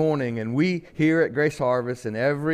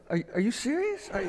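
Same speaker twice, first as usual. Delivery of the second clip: high mock disbelief, retold; 10 semitones higher, 3 dB quieter, faster than usual. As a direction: squeak out a teasing are you serious. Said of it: "I'm going".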